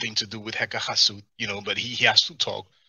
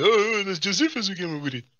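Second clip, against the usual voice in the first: high, louder imitating voice; mock imitation; 9 semitones higher, 4 dB louder than usual. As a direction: parody his claim in a high voice.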